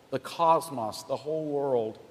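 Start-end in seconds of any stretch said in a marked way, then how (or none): none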